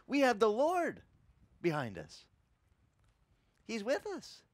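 usual speaking voice

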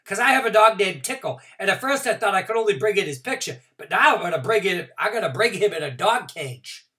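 put-on high, loud, fast kid chatter; relieved excitement. A narrator's reading. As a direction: gush with relief and plans. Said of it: "I said".

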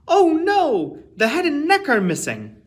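high, loud alarmed cry; dismayed oh no. Acted. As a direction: gasp it out as the bad news hits. Preparators find bones missing.

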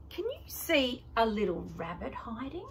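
usual speaking voice

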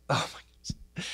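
soft, slow breath of disbelief; stunned whisper. Acted. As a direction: whisper it as if awestruck by a near miss. Imagined reaction to charging bear.

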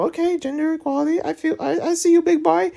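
high, soft falsetto teasing; squeaky mock voice. Player teasing a game character, falsetto. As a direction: coo it in a silly falsetto.

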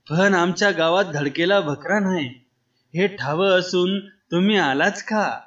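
plain reading voice

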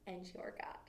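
whispered end of the line; hushed. A narrator's reading. Jesus ends his message to Mary in a whisper. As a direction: let it fall to a whisper. Quote fading to hush.

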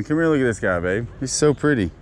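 ordinary delivery

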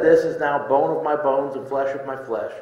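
ordinary delivery

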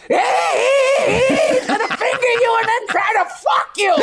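long high squawked "hey", then shrieking parrot; high-pitched parrot holler. Acted. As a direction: screech it like a gleeful tattletale parrot. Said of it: "and the parrot says".

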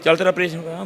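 high, carrying voice of a tactless son; acted loud, high-pitched announcement; loud-mouthed son. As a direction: blurt it loudly at your father. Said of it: "ਉੱਚੀ ਤਰ੍ਹਾਂ ਬੋਲਦਾ".

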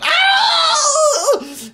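piercing high shrieked ow; yelled ow. A narrator's reading. Grandma yelling as the medicine hits. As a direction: shriek it out in shock. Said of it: "Grandma yelled".

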